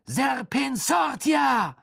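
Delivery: high, slow, drawn-out shout; barked spell. A narrator's reading. Pupil casting a snake spell, barking it out. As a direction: bellow the incantation syllable by syllable. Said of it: "und bellte".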